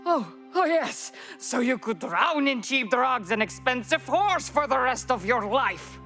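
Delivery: high, scornful mock realization building into accusation; angry, sarcastic tirade; bitter sarcasm. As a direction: pitch leaps up, dripping with contempt. Bitter quarrel over being abandoned.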